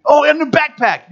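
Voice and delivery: high-pitched gushing, sending himself up; nerdy excitement. Retold or imitated. Preacher mocking his nerdy love of camping, nerdy excitement. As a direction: gush in a high, geeky voice. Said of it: "getting all nerdy about camping".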